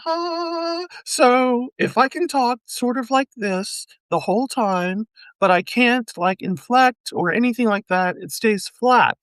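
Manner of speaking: high, held-up voice. Shown as a demonstration. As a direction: lift the voice high and keep it. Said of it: "let me see if I can do it".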